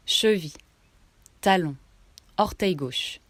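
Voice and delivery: ordinary talk, nothing marked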